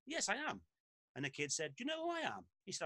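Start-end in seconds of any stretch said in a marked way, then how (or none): 0.1-0.6 much higher, self-satisfied confirmation; hammed-up pompous boss; smug manager. Clough confirms smugly. 1.8-2.4 much higher, mock-indignant turning the tables; cheeky comeback. Lad turns it back cheekily.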